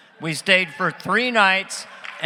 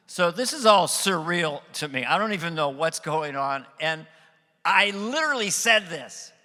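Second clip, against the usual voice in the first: ordinary delivery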